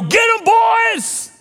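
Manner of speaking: high, drawn-out rallying shout; mock battle cry. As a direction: sic your gang on them, hammed up. Jesus imagined ordering an attack.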